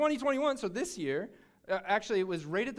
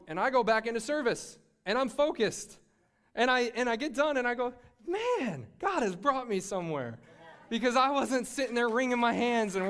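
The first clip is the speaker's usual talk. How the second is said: louder, fired-up sermon delivery; preaching at full force; 4 semitones higher, 4 dB louder than usual. Acted. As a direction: preach it, the congregation hanging on every word.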